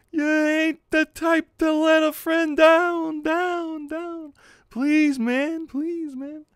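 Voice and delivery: high, drawn-out begging that fades away; pleading pal. Acted. Punk pal begging a friend not to let them down, pleading.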